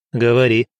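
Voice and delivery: brief, firm command; curt order. A narrator's reading. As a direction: give a short order to report. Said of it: "коротко приказал я".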